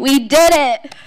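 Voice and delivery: loud, joyful cheer; excited shout. Real, not acted. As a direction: shout it out in triumph. Graduate celebrating at ceremony.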